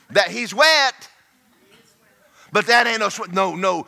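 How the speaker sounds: normal talking voice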